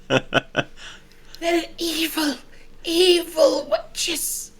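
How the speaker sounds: very slow, drawn-out evil; slow insistence. Acted. Villager woman slowly insisting to the party they are witches. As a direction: stretch each word out with dread.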